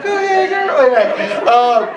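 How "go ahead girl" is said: put-on high, sing-song girly go-ahead; girl impression. Preacher mimicking his date, high girly voice. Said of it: "That's my impression of girls".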